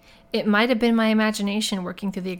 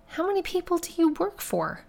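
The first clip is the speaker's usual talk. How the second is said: high-pitched, incredulous question; suspicious and incredulous; exasperated disbelief; 10 semitones higher than usual. Acted. Woman distrusts her would-be rescuer.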